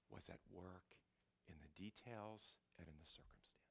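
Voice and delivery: soft, whispered awe; hushed whisper. Acted. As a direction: let it drop to a whisper. Whispered awe of God at work.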